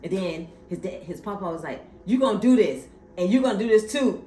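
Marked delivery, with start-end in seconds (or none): 2.1-4.2 commanding. Retold or imitated. Papa voiced commanding.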